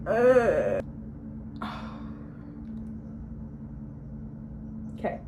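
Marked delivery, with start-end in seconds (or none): 0.9-4.9 a long, breathy, voiceless sigh out; long soft sigh. Real, not acted. Gathering her thoughts for viewers, a long sigh. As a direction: let all the air go slowly. Collecting herself after interruption.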